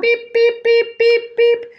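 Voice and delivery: high, loud electronic beeps; imitated beeping. Mimicking the app at the dinner table, high beeps. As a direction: beep like a shrill app alarm.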